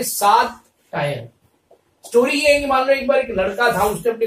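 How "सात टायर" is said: सात टायर as two words, सात then टायर; सात टायर अलग-अलग बोलो; split into saat tyre. Shown as a demonstration. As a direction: say सात टायर, like seven tyres. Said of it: "सटायर word को क्या बना रहे हैं".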